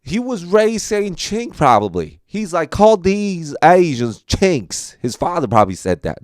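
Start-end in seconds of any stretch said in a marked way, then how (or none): 2.7-5.0 put-on Southern accent. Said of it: "Southern accent".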